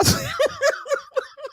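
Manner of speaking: breaking into high-pitched laughter; helpless high giggling; real high laugh. Real, not acted. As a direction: crack up after your own parody. Cracks up at own parody.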